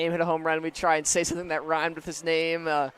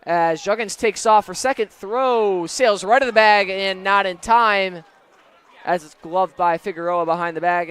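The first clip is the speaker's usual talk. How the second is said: live play-by-play; 6 dB louder than usual. Shown as a demonstration. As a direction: call the steal like it's live. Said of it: "doing play-by-play on request".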